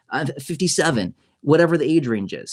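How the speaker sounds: ordinary talk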